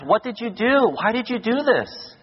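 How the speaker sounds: high-pitched, appalled wife scolding; upset wife. Retold or imitated. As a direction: demand it like a shocked wife. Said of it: "let's say the wife says".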